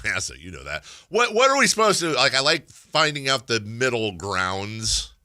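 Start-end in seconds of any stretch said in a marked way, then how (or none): none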